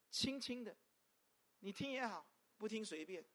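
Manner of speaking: 1 softly. Shown as a demonstration.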